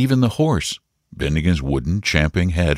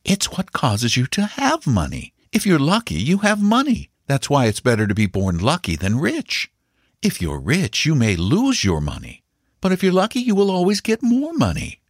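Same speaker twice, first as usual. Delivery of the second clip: higher-pitched, instructive mother's voice; mother explaining; 9 semitones higher than usual. A narrator's reading. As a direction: spell it out patiently for a child.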